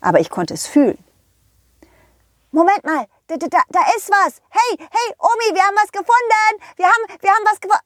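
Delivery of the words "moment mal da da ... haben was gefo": put-on high, hurried, stammering boy; excited little pirate. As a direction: call grandma urgently, tripping over your words. Pirate boy finds something.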